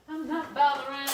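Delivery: playful repeat. Real, not acted. Cook telling live viewers she skips browning, teasing. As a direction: toss the words off cheekily.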